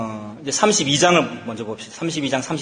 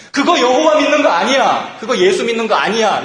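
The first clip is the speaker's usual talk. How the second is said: hammed-up furious rebuke, much higher and louder; Moses's angry rebuke; 7 semitones higher, 6 dB louder than usual. Moses rebuking the golden calf worshippers, angry shout. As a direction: bark it down at them in outrage. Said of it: "하나님의 분노로 화를 내면서".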